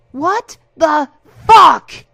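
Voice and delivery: very loud, high, slow scream; outraged shout. Acted. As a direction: bellow in shocked outrage. Harasser slapped to floor.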